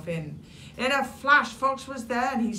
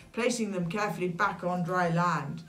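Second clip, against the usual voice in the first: ordinary delivery, nothing marked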